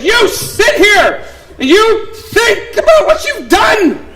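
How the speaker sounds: slow, high, loud stern command; stern dad. Retold or imitated. Father to son in the bathroom, stern slow command. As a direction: lay down the law, slowly. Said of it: "and I said".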